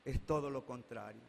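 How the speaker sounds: muy grave y suave, un toque de llanto; madre llorosa. Retold or imitated. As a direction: deja que se le quiebre un poco la voz. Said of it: "Se pone a llorar y me dice". (madre confesando su culpa, con llanto contenido)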